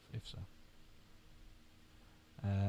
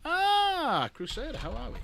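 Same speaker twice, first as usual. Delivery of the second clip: much higher, bright hello to a friend; excited greeting; slower than usual. Real, not acted. Greeting the caller Crusader with a high delighted lift.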